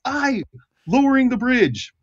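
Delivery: high-pitched guard, subdued reply; chastened guard. Acted. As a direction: answer the order meekly in a high voice. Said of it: "And then you hear".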